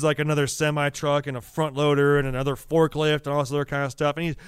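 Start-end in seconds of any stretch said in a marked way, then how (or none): none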